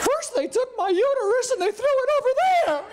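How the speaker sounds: high, delighted voice through laughter; giddy wife. Retold or imitated. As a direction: play the wife thrilled by the mess. Wife describing surgery, quoted.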